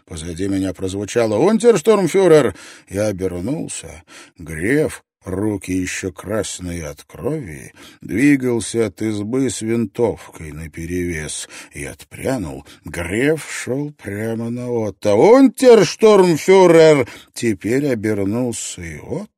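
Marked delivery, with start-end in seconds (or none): 1.4-2.6 loud, high shout from behind; calling out. A narrator's reading. Gref calls to Ott from behind, loud shout. 15.1-17.1 loud, high, drawn-out shout; shouted challenge. A narrator's reading. Gref advances on Ott with rifle, shouted challenge.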